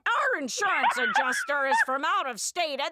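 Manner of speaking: high, slow mock gushing; Frank. Frank praising the body shop, high hammed-up delight. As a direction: draw out the love in a high caricature.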